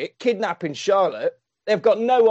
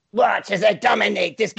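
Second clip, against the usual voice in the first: bad guy voice; 4 semitones higher than usual. Shown as a demonstration. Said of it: "shall I do it in the bad guy voice".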